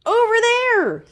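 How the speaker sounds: very high, eager shout; little guide spotting the light; excited cry. A narrator's reading. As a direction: spot the way out and call it. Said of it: "Zeke cried".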